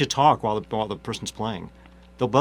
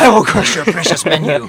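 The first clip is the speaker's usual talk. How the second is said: barbarian; 4 semitones higher, 7 dB louder than usual. Pretend. Mock threat, laughing.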